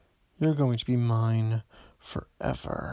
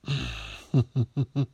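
sinister chuckle. Acted. Villain laugh.

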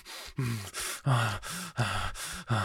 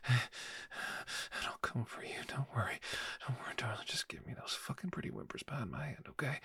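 whispering; 10 dB quieter than usual. Acted. Aside whisper during call.

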